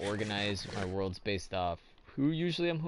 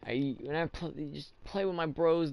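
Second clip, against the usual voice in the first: usual speaking voice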